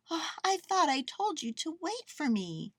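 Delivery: put-on high-pitched girl, mildly chiding; Lisa. A narrator's reading. Girl to her runaway bear, mildly chiding. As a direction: chide gently in a high voice.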